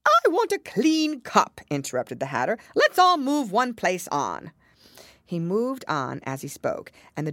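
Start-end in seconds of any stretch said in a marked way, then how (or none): 0.0-4.5 bossy mad hatter voice. A narrator's reading. Hatter character voice.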